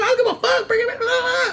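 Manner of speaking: high, loud defiant challenge; defiant yell. Retold or imitated. Telling off the coworker who taunts her, high loud defiant yell. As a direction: throw it back in her face. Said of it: "I'm like".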